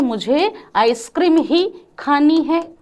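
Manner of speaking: ही stretched and leaned on, slow; stress on ही. Shown as a demonstration. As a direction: press the word ही hard. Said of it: "किस पर बल दे रहा है". ("ही" पर ज़ोर देकर)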